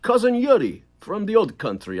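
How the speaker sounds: put-on Cousin Yuri's Russian accent from the old country; lightly put-on Russian immigrant voice; playful Russian accent. Shown as a demonstration. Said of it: "talking like this".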